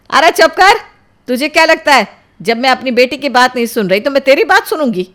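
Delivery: loud, high, indignant outburst; furious mother. Acted. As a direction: shut the boy up angrily. Mother silences the boyfriend.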